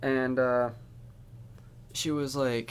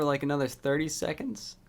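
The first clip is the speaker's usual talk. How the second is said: ordinary delivery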